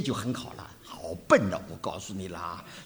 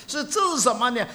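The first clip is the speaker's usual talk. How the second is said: laughing; 12 semitones higher, 6 dB louder, much faster than usual. Real, not acted. Laughs while asking.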